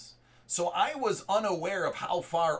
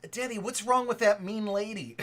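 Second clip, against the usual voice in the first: higher, childlike voicing of a small boy; imitating his son; 5 semitones higher than usual. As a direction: ask it like an innocent four-year-old. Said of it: "'cause kids say the darndest thing".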